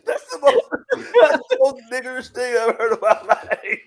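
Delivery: high-pitched, laughing through the words; real incredulous laughter. Real, not acted. Listener reacting to co-host's crude Honda story, laughing hard. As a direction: crack up while protesting, voice shooting up.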